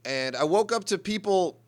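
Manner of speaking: usual speaking voice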